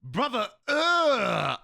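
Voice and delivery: high, loud, drawn-out mock disgust; hammed-up appalled meme voice; meme impression. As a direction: stretch the disgusted groan way out, pitched high. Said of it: "That was my favorite meme".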